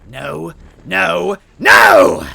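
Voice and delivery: high desperate scream of no; frantic rising no, no, no. A narrator's reading. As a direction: shriek it in helpless rage. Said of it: "Kecleta screamed".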